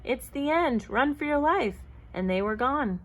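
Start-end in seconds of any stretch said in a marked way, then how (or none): 0.0-1.8 higher-pitched cry of warning; panicking animals fleeing; alarmed cry. A narrator's reading. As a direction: urge them to run, voice pitched up. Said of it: "cried the animals".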